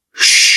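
loud imitated hiss of a passing ball; sharp hiss of something flying past; hissing whizz. A narrator's reading. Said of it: "Egy labda sziszegett el a füle mellett".

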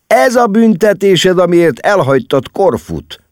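very high, loud pronouncement; snorting mockery. A narrator's reading. Larry explains to mama her punishment for leaving Corfu, scoffing.

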